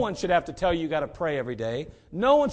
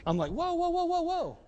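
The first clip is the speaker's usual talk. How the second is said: high-pitched joking whoa, half laughing; imitating his wife, lightly playful; mock warning whoa; 10 semitones higher, slower than usual. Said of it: "She's like".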